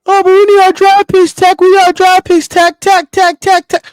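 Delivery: high, loud mocking whine; hammed-up pleading front office voice; mock whiny team exec. Mocking team's whiny reply.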